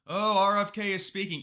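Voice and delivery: high-pitched imitation of a clueless news anchor; mock lackadaisical anchor. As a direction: put on an airy, oblivious anchor voice. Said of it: "acting lackadaisical".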